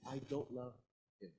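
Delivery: ordinary talk, nothing marked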